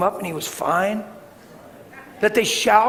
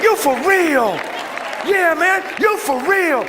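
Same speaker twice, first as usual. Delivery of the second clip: loud, high Southern exclamation; whooping Southern amazement; amazed Southern man; 8 semitones higher, 4 dB louder, slower than usual. Retold or imitated. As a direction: imitate an astonished man, building. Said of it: "Watch what he said".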